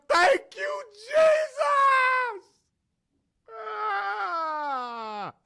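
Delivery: very high, loud shriek of joy; ecstatic scream. Retold or imitated. Plumber finding the hidden cash screams in joy, very high. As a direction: scream thanks at the sky.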